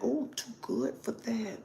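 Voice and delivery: high, hushed snooty voice; snooty prude. Retold or imitated. Mocking people who think themselves above it, hushed snooty voice. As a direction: play the uppity prude. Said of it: "some people think".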